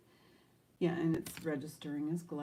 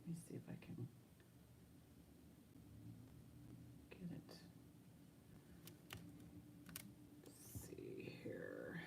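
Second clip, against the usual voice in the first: whispering; 20 dB quieter than usual. Real, not acted. Muttering while fiddling with camera.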